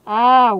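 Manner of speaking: sudden loud shout of "ah"; a full-throated yell; real yell. Real, not acted. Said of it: "désolée si je gueule comme une moche". (cri soudain et très fort)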